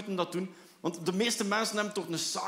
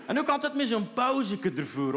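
normal talking voice

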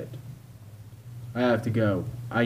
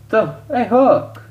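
high, loud shout; sudden high-pitched holler; calling out; 12 semitones higher, 9 dB louder, slower than usual. Acted. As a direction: yell to get their attention. Calling out sharply mid-scene.

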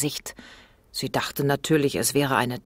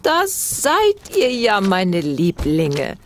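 slow, high, louder granny greeting; grandma calling out; 6 semitones higher, 5 dB louder, much slower than usual. A narrator's reading. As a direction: call out lovingly like an old grandmother. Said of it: "rief Oma". (Oma ruft ihren Enkeln zärtlich zu)

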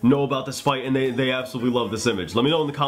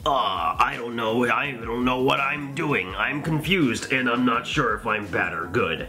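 slow, dopey mock-confused voice; hammed-up dithering; confused brother; 3 semitones lower, slower than usual. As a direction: drag it out like a muddled, unsure villain. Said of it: "his brother who's like".

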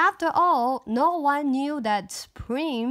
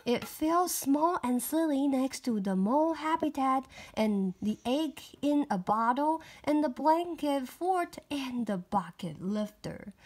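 ordinary delivery, nothing marked

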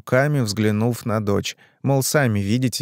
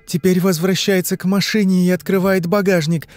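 put-on higher-pitched girl answering questions; young woman in trance; 8 semitones higher, 3 dB louder than usual. Girl answering the hypnotist's questions, higher entranced voice.

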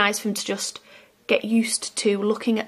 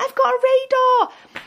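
put-on high, slow child voice; imitating a delighted small boy; excited little boy; 3 dB louder than usual. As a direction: squeal it like a thrilled four-year-old. Child's excited exclamation retold.

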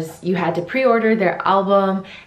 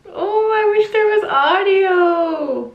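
real sob in the voice; real tearful wail; 12 semitones higher, slower than usual. Real, not acted. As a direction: cry it out, voice breaking high. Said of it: "Oh, I'm so sad".

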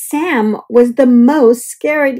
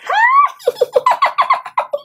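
very high burst of happy laughter; delighted laugh. Acted. Leonardo and Frankenthaler surprised and delighted.

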